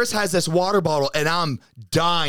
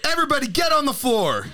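mock robber; 12 semitones higher than usual. Joking as guests arrive, hammed-up robber call. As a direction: bark it like a playful stickup.

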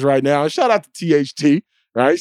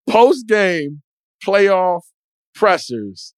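ordinary delivery

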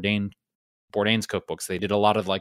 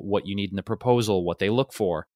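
normal talking voice

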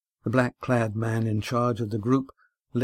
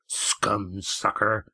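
grunted; much slower than usual. Acted. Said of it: "grunted Red Ian".

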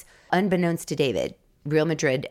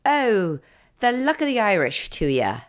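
a bad stage Irish accent, higher and lilting; hammed-up Irish brogue; 5 semitones higher than usual. Showing off her Irish brogue to her co-host, hammed-up Irish accent. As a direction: ham up an Irish brogue for the laugh. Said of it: "I can do an Irish brogue".

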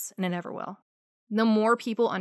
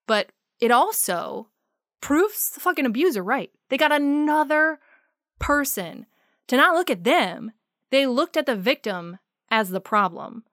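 rising angry voice, higher and louder; heated rant; 4 semitones higher, 4 dB louder than usual. Real, not acted. Survivor rails at listeners who blame victims, growing angry. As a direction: let the anger boil over, voice climbing.

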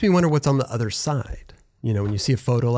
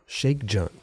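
normal talking voice

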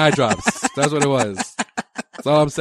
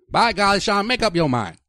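higher, mock Indiana twang; Indiana accent; 7 semitones higher than usual. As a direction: chide like an Indiana local. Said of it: "Kept saying over there in Indianapolis".

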